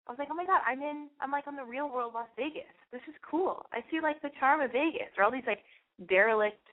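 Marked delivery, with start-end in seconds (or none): 0.0-5.1 high, giddy rush of delight; breathless starstruck realization; excited gasp. Retold or imitated. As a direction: replay the thrill of discovering Vegas. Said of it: "Then all of a sudden I was like".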